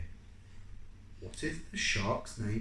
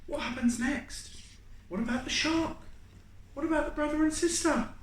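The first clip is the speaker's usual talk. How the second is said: higher, quick, eager teacher questions; teacher pressing for more, higher and faster. Acted. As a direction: ask like a curious teacher. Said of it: "says Missus Singh".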